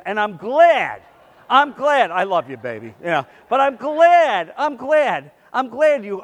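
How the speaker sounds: loud, repeated I'm glad, spat out; loud gloating. Retold or imitated. Spiteful man gloating loudly.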